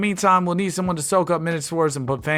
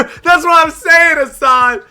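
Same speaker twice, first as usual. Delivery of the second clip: much higher and louder, slow, shouting at the screen; excited shout; 11 semitones higher, 10 dB louder, much slower than usual. Real, not acted. Streamer yelling at Hassan on screen, loud shout. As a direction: yell it out at the player.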